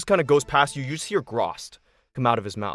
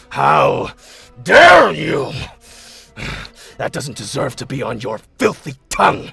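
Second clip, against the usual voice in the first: villain roars; 9 dB louder than usual. Acted. Villain roars at Artie who dared speak his name. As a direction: explode at them with rage. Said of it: "he looks at you and he says".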